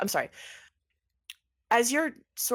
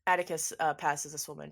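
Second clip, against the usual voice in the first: ordinary delivery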